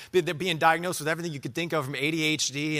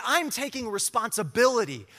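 much higher, ringing proclamation; preached declaration; 10 semitones higher, 4 dB louder than usual. Acted. Preacher declaring to the church, much higher and ringing. Preaching climax, repeated later quietly.

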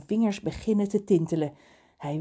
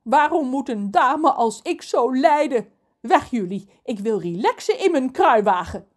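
raised, theatrical lament; wailing complaint; 6 semitones higher, 6 dB louder than usual. A narrator's reading. Lorelei shoos the pirates off, wailing complaint. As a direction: lament like a much-suffering lady. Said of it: "jammert ze".

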